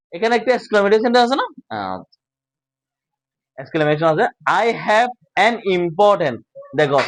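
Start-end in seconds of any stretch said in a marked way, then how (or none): 4.4-6.4 slow, word-by-word reading; measured classroom dictation pace; slow model reading. Shown as a demonstration. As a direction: read it out slowly for learners to follow. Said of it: "আলাদা আলাদা করে উচ্চারণ করবা".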